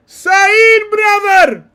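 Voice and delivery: a friend calling out to the sweating pundit, loud and pleading; mock calling out. Real, not acted. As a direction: call out to him in mock anguish.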